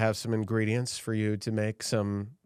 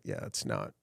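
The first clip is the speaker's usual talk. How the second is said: sly quiet innuendo; 6 semitones lower, 10 dB quieter than usual. Acted.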